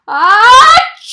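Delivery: loud, high comic achoo; big sneeze. A narrator's reading. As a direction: blast out a giant sneeze. Gerald's huge sneeze.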